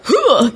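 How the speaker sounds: high, short samurai attack noise; imitated fighting grunt. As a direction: mimic a game character's strike grunt. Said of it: "he makes, like, a".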